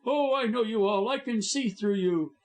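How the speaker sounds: savagely crying out. A narrator's reading. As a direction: lash out at them. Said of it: "he cried savagely".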